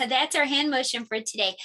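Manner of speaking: ordinary talk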